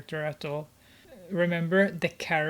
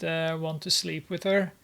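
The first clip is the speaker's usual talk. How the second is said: usual speaking voice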